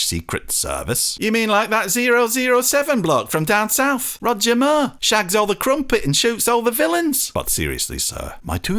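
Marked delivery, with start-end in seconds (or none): 1.2-7.3 high, hearty shop-counter welcome; cheery northern shopkeeper. Acted. Shopkeeper greeting wet strangers, cheery northern voice. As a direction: greet the lads like a jolly shopkeeper.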